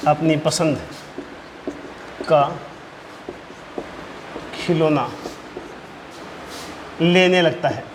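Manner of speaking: very long pauses between short phrases. Real, not acted. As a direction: leave time to write after each word. Teacher dictates point word by word.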